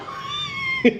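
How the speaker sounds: high laugh. Real, not acted. Reacting to Noah leaving.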